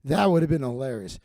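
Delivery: ordinary talk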